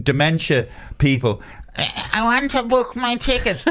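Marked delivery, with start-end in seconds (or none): 1.8-3.6 put-on high, slow, feeble old-timer voice; a doddering pensioner asking for help, hammed up; mock old pensioner. Travel scam victim mocking himself to the agent, high and slow feeble old voice. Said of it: "little fucking O A Ps like me".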